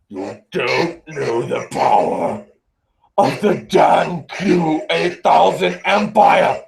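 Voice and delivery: slow, booming masked villain; menacing, drawn-out decree through a mask; Darth Vader. Acted. Streamer in mask recruiting fans to his empire, villainous Vader voice. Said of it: "I'll do a better Vader impression".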